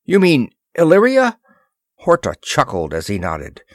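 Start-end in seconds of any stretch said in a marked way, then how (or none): 0.0-1.4 high and loud, caught off guard; startled question. Acted. Ross startled as Horta names his queen, loud high surprise. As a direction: blurt it out in shock.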